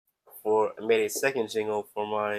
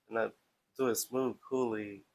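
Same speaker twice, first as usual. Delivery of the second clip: ordinary talk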